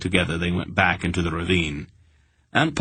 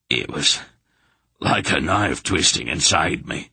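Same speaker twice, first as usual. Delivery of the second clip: ordinary delivery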